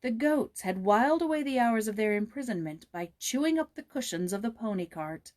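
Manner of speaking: ordinary delivery, nothing marked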